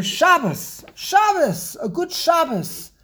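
"Shabbos" with a high, strong first syllable; first-syllable stress. Shown as a demonstration. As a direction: stress the first syllable of "Shabbos", letting the second fall away. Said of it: "it's the first syllable".

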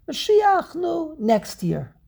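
high, slow brush-off; mock pleading refusal; protesting celebrant. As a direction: put someone off in a high, stretched voice. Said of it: "And you say to Mashiach".

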